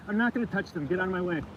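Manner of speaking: ordinary delivery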